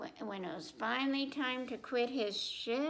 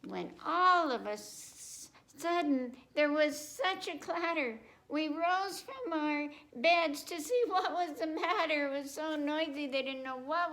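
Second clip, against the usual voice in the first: rising, brighter read-aloud; lively, higher story voice; excited storytelling; 5 semitones higher, 4 dB louder than usual. A narrator's reading. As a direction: build the surprise of the clatter.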